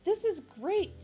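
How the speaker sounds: high, loud sputtering sarcasm; loud high spluttering protest; sputtering Arthur. Acted. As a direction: splutter it out in panic. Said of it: "sputtered Arthur".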